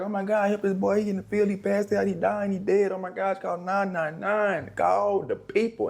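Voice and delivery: high frantic mock panic; hammed-up alarm calling for help; panicked quote. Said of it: "they're like".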